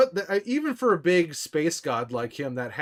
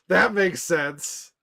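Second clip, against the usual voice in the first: amused; 7 semitones higher, 9 dB louder, much slower than usual. Real, not acted. Reacting after laughing at chat.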